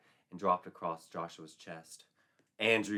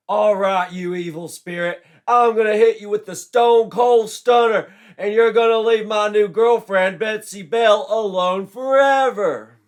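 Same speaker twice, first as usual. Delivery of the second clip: high, very loud shouted threat; wrestler's threat; 13 semitones higher, 20 dB louder than usual. A narrator's reading. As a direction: bellow it like a pro wrestler. Joshua threatens the evil spirit.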